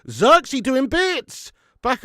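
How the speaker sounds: high, loud, drawn-out cheer; excited match shout. Real, not acted. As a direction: yell it slowly as the attack unfolds. Calling live match action.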